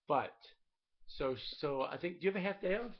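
usual speaking voice

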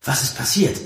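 concerned, urgent call; worried. A narrator's reading. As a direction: ask in alarm. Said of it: "Saphiras besorgten Ruf".

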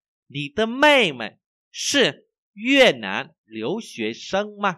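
very slow, loud, word by word; language teacher's model reading. Shown as a demonstration. Teacher reads for learners to repeat, slow model reading. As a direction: exaggerate each tone, high and clearly spaced.

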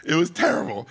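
ordinary talk

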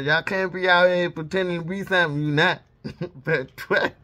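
ordinary talk

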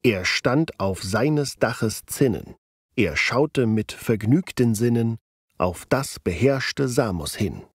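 slow, clearly marked iambic rhythm; iambic stress. Shown as a demonstration. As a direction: lift every second syllable. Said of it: "Das siehst du zum Beispiel an". (langsam, jambisch betont vorgetragen)

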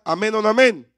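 booming call to the congregation; loud call-out. Real, not acted. Preacher calls out to the congregation, booming. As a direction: throw it out to the room, demanding an answer.

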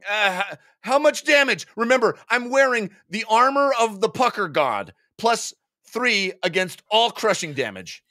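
high, pleading bargain; anxious paladin player. Acted. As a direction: plead for armor bonus.

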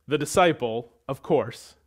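normal talking voice

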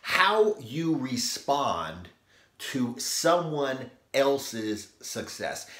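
ordinary talk

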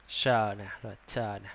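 ordinary delivery

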